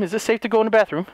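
normal talking voice

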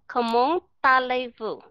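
"Không muốn ta lê vụ" slowly, T carried into "ta"; slow French liaison. Shown as a demonstration. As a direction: draw out the French phrase "Không muốn ta lê vụ", linking the T. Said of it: "đọc âm T nó đi chung". ("Không muốn ta lê vụ" chậm, nối âm T sang "ta")

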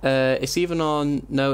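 ordinary delivery

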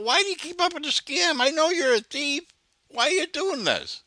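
mock him in a high silly voice.

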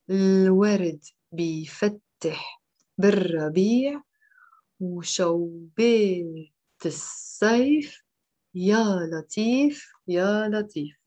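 slow, phrase-by-phrase teaching read with pauses; slow read-aloud. Shown as a demonstration. Teacher reading the song for muted students to repeat, slowly. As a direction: draw out each word so learners can repeat. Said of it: "read it once slowly without the explanation".

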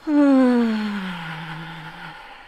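loud, long sigh of release; big sigh. Real, not acted. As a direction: sigh it all out after a shake. Breathing out after shaking limbs.

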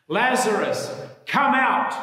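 loud, high commanding call; loud cry. A narrator's reading. Jesus calls dead Lazarus out of the tomb, loud cry. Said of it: "cried with a loud voice".

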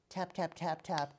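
small taps. Shown as a demonstration. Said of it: "Small note".

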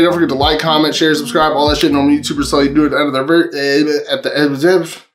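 rattled off at top speed, tripping at the end; rushed, garbled sign-off; too fast. Real, not acted. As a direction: race through it until the words tangle. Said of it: "how do I say that so fast".

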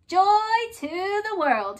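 slow, high and loud proclamation; proclaiming moose. A narrator's reading. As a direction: proclaim it grandly. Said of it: "he said".

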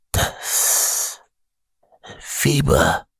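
weak, rattling croak; gurgling rasp. A narrator's reading. Risen Lazarus remembers his fever, gurgling. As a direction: force the words out like a death rattle. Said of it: "Stimme klang gurgelnd, wie ein Röcheln".

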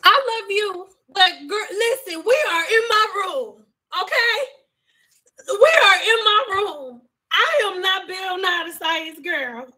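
yelling at someone to leave her room; exasperated shouting. Real, not acted. Exasperated with family over livestream.

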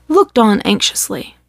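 ordinary delivery, nothing marked

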